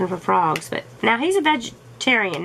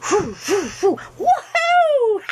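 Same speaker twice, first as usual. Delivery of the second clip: cowboy whoop; 11 semitones higher, slower than usual. Acted. Pretending to lasso a fish, hollering a rodeo whoop.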